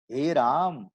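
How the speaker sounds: हे राम with the vowel held long. Shown as a demonstration. Teacher demonstrating the long vowel, held steady. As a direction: draw out हे over two beats. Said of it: "ये हो गया दीर्घ".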